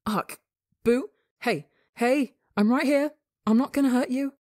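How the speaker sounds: scared partner calling him back; frightened pleading. A narrator's reading. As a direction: plead with rising fear.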